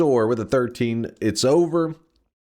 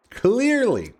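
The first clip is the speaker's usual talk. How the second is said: "Clearly" pitched higher, exaggerated sarcasm; sarcastic higher; 10 semitones higher than usual. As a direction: push "Clearly" up higher and overplay it.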